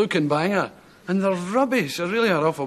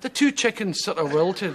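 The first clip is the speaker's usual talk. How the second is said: normal talking voice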